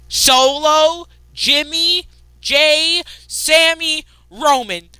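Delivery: each name called out high and loud; hyped roll call. Acted. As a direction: shout the names like a hype man.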